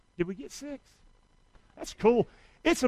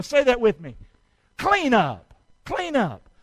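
ordinary talk

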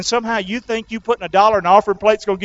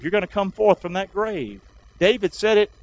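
ordinary talk, nothing marked

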